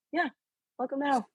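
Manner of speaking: cheerful welcome with a smile; smiling. Shown as a demonstration. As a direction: grin through a grim line. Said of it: "smiling as I say it".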